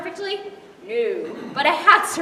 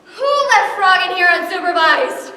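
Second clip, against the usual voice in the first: angry; 9 dB louder than usual. Acted. Angry at the mess.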